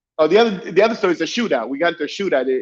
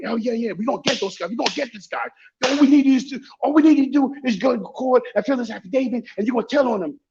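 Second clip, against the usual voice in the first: boss voice; 7 semitones higher than usual. Retold or imitated.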